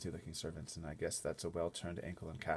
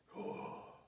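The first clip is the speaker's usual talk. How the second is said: gasp; 5 dB quieter than usual. Acted. Narrator gasps at hesitation.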